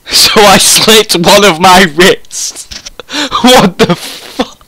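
high, slow words through laughter; real laughter breaking up the words; laughing. Real, not acted. Reader in disbelief at the story, laughing.

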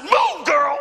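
angry yell to clear the doorway; retold shout. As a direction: bark the order to move aside. Acted quarrel with wife at door.

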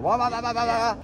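high-pitched engine wah-wahs; imitated over-revving engine. Coach urging a shift up, high revving imitation. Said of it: "grab another gear. You're".

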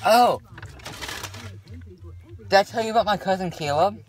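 usual speaking voice

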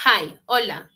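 ordinary talk

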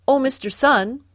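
calling out. A narrator's reading. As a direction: call up to someone far above you. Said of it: "called Father Mouse".

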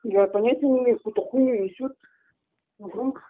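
tinny phone-line voice; over the phone. Real, not acted. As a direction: heard through a phone speaker. Prank call, woman answers phone.

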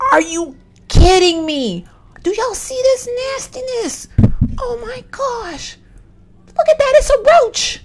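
high, drawn-out disgusted squeal; grossed-out freak-out. Real, not acted. Player spots roaches on the game screen, grossed-out freak-out.

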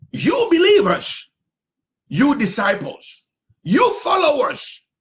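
high, loud rising call to the believers; fervent preacher's cry; preaching shout. Acted. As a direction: call out each name like a summons. Preacher calling out to hearers.

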